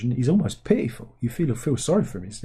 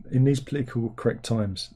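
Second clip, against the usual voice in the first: ordinary talk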